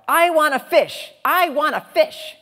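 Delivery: put-on high, loud little-girl shout; child shouting. Child yelling at the playground for a snack. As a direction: belt it out like a demanding toddler. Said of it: "This, this voice shouted out".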